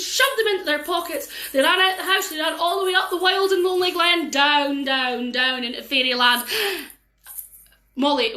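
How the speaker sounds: usual speaking voice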